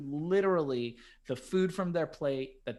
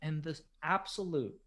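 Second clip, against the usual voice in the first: ordinary talk, nothing marked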